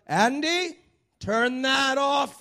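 high, slow, loud shouted order; furious mock warden shout; warden yelling. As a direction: yell it through the glass. Warden yells through the window.

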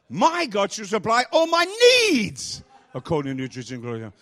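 building loud and high to a long shouted NEEDS, then dropping; stress on NEEDS. Shown as a demonstration. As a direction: NEEDS cried out at full force. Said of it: "emphasizing one word at a time".

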